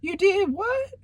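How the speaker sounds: mother hears he cheated, high and shocked; shocked mom. Retold or imitated. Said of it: "my mom was like".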